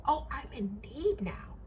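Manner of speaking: whispered, slow mockery of his needy tone; mock meek whisper. Mocking the customer's sudden helplessness, in a whisper. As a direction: breathe it like a pitiful victim. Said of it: "and I'm meek".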